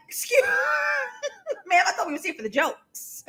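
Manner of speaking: laughing through a mock complaint; laughing protest. Real, not acted. Host teasing the writer, laughing through it. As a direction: giggle out a playful objection.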